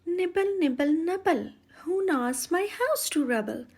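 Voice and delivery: high, thin witch's call from inside; sing-song thin call from within the house. A narrator's reading. Witch calls out to the children nibbling her house, thin and high. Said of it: "heard a thin voice call out".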